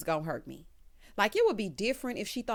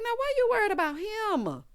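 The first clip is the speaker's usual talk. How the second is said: imitated indignant whine; 13 semitones higher, 4 dB louder than usual. Danny scolding Howard, high mock-indignant wail. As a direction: wail it high, stretching HIM. Acted indignant complaint, first take.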